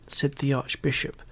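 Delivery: ordinary delivery, nothing marked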